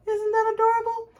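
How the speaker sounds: high, loud and quick, squealing over something cute; delighted squeal at the finished bookmark; gushing delight. Real, not acted. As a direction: gush over how adorable it is.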